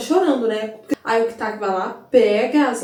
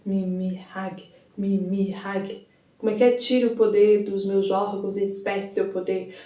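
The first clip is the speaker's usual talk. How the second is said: slow, much softer solemn chant; ritual incantation; 3 semitones lower, 5 dB quieter, slower than usual. Acted. Kitaki calling on Mimihage to take his organs, solemn chant. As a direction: intone it like a sacred invocation.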